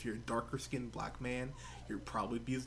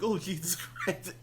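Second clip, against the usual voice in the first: laughing exclaim; 6 semitones higher, 4 dB louder than usual. Real, not acted.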